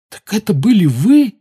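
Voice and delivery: shocked, slow gasped question; gasping. A narrator's reading. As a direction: gasp it in disbelief. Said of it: "ахнул Гарри". (ошеломлённый ахающий вопрос)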